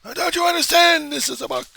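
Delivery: high-pitched, loud freakout; frantic outburst. Acted. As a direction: act out a hysterical plea. Mock freakout over the sign.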